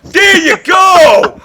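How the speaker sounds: high, loud, drawn-out cheer; excited shout. Real, not acted. Coach cheering on his student, excited shout. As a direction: holler it with delighted approval, stretching the words.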